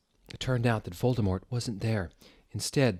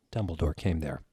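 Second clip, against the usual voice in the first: normal talking voice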